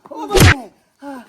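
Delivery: long, loud drawn-out call across the yard. Acted. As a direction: summon him at full voice. Calling out to Saganiya. (મોટેથી લાંબી બૂમ)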